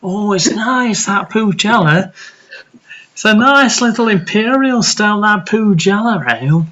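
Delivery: high, swooping put-on Mancunian; [name]. [name] asking for Pujala ale, mock Mancunian accent. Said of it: "you're doing a Mancunian accent".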